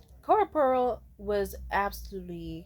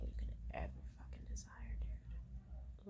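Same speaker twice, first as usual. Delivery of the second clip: hushed unvoiced whisper; 18 dB quieter than usual. Real, not acted. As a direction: lean in and whisper it. Whispered emphasis on desire.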